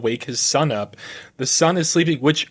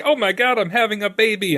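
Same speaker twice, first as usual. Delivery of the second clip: excited; 9 semitones higher than usual. Retold or imitated. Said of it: "He's going into this, like".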